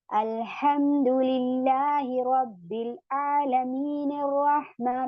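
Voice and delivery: अल्हम्दुलिल्लाहि रब्बिल आलमीन slow, run straight into अर्रहमान; joined reading. Shown as a demonstration. Teacher shows the students how the words join. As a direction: link the two phrases smoothly. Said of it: "फिर इस तरीके से हम मिलाकर पढ़ेंगे".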